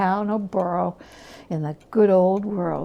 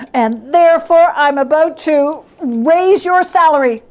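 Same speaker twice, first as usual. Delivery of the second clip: higher, louder, bursting with glee; joyful reveal; 6 semitones higher, 8 dB louder than usual. Acted. Scrooge revealing Bob's raise, gleeful and loud. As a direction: springs the surprise with delight.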